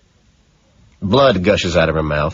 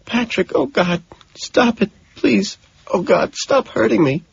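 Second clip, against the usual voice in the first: much higher, thin begging voice; pleading victim; 13 semitones higher than usual. Acted. Woman begging Patrick to stop, high frightened pleading. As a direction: beg him to stop in a high pitiful voice. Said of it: "she starts pleading".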